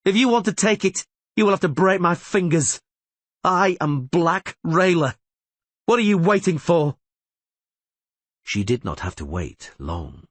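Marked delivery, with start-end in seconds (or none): 0.0-7.0 high, loud taunting battle cry; defiant challenge. A narrator's reading. Rayla loudly taunting the elves to attack. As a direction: dare them to come.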